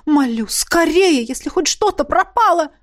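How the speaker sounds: high, alarmed plea; urgent pleading. A narrator's reading. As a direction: beg them to hurry, pitch climbing. Priest urgently begs after break-in. (взволнованная мольба)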